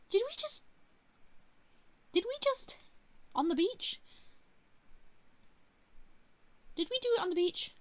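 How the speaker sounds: loud, high-pitched squeal of a question; scandalised, voice shooting right up; shocked disbelief. Acted. Heroine stunned by what happened on the beach, shocked disbelief.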